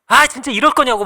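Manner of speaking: angry retort. Retold or imitated. Junior lashing out at father.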